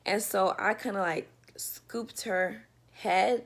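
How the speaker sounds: normal talking voice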